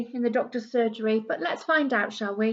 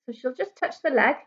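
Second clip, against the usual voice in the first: plain reading voice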